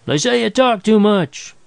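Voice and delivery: high, drawn-out yell; acted slow, high-pitched shout. A narrator's reading. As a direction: yell a parting line after someone. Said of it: "he yelled as he slammed".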